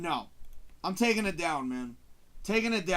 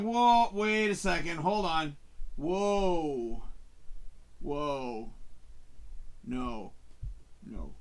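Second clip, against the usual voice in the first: alarmed disbelief; 3 semitones lower, much slower than usual. Retold or imitated. Viewer watching the movie go wrong, fading dismay. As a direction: start alarmed, then sink into denial. Reacting as the movie turns bad.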